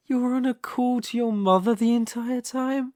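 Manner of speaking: high-pitched, delighted astonishment; incredulous villain, voice lifted high; amused disbelief. Acted. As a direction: marvel at the trick, still tickled.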